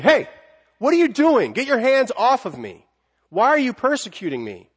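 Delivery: an angry Jesus confronting a persecutor; mad. Acted. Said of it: "Jesus is mad".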